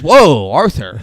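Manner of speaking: loud, mock-startled whoa; shocked protest. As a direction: imitate a taken-aback guest objecting. Retold shocked reaction to insult.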